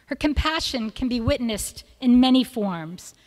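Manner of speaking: ordinary talk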